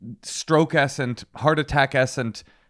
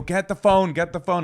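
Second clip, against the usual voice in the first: raised, higher retold call; retold urgent yelling; 7 semitones higher than usual. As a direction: re-enact urgently calling for the phone. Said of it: "she's panicking".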